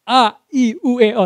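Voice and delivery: loud run of clear vowels; big vowels. Shown as a demonstration. Trainer showing homework drill, big clear vowels. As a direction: punch out the vowels one by one. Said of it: "ngucapin huruf vokal secara, eh, besar".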